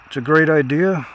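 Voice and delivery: ordinary talk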